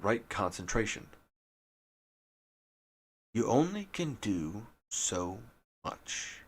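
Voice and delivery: ordinary talk, nothing marked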